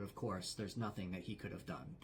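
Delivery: ordinary delivery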